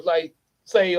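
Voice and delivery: ordinary delivery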